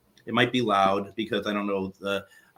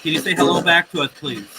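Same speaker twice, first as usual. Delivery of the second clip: raised, higher voice calling out to unseen spirits; 7 semitones higher than usual. Acted. Medium addressing spirits through the device, raised and higher. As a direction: project up and out into the empty room, inviting.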